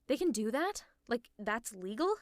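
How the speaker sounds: astonished, pitch leaping up at the end; incredulous. Acted. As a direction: question it like you can't believe it. Disbelief at League's hiring practice.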